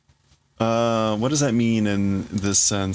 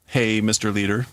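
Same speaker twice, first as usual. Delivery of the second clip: ordinary talk, nothing marked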